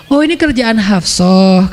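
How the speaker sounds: slow, drawn-out dawning; slow realization. Acted. Prophet realizing who leaked the secret, slow. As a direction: let it sink in slowly. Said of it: "kata Nabi".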